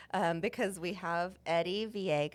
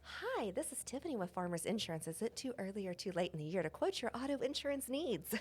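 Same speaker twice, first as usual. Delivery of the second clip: soft, smooth cold-call script; phone operator sales voice; 2 semitones higher, 5 dB quieter than usual. Shown as a demonstration. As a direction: recite the pitch like a sultry phone operator.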